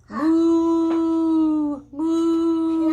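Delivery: loud, long held moos; big cow moo. Shown as a demonstration. Showing a toddler another big cow, loud mooing. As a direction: bellow two sustained moos. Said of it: "Ooh, another big cow".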